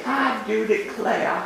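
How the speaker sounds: slow, laughing Southern belle "I do declare"; mock South Carolina drawl; Southern accent. A priest imitating South Carolina students, Southern accent. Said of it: "they say".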